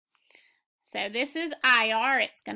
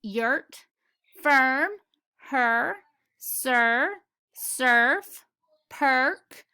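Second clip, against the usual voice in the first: each word punched out separately, louder than her usual voice; word list read aloud; 2 semitones higher, 4 dB louder, much slower than usual. Shown as a demonstration. Teacher drilling the murmur-diphthong words with children, emphatic and louder. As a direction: call out every word crisply like flashcards for the class.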